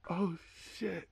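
very soft, stunned murmur; hushed awe. Retold or imitated. Listener retelling his reaction to a verse, hushed awe. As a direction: let it out under the breath.

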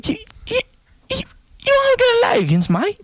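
a squeaky Aussie who slips into pirate; pirate-ish Australian. Acted. Inviting Josh to Logan's, in a high mock Australian voice. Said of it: "that's like a pirate".

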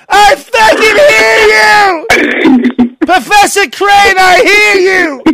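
high, slow, furious yelling; angry shout. Retold or imitated. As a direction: bellow back at the speaker. Imitating Sam yelling at pages.

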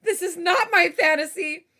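high, loud, flustered panic; stressed rant. Real, not acted. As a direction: vent in a stressed rush. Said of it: "I'm genuinely stressed right now".

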